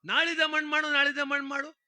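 mock her, high and loud; mocking mimicry. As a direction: parrot her words back in a high, loud sneer. Headman mocks the wife's request.

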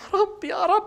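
high, laughing cries of delight; laughing joy. Real, not acted. Student sees his grade, laughing with joy. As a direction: laugh out your relief.

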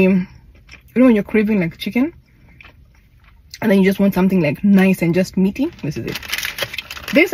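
eating burger between words; talking while chewing. Real, not acted. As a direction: mouth partly full of food. Seen 2: Holds burger, closed-mouth chewing between words, licks fingers.

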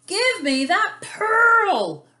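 loud, high, drawn-out storybook roar; big acted villain's demand. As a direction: roar it slowly as a fierce eel, stretching the last word. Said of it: "roared the eel".

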